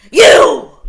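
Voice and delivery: huge loud high roar; wolf shout. A narrator's reading. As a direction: bellow it to scare her. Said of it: "cried the wolf".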